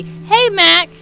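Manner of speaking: high, loud greeting call; hailing a chatter by name, high and loud. Real, not acted. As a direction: call out brightly to a friend in chat.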